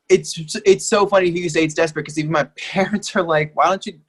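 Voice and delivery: ordinary talk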